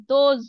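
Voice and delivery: "Those" with a rounded o and buzzing z end; those with oz sound. Shown as a demonstration. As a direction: round the o, buzz the z. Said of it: "ওজ sound হবে".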